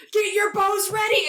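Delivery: very high-pitched battle order; rousing command. Acted. Game master as character motivating everyone, high rousing call. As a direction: urge the archers on, hammed up. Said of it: "motivating everyone to".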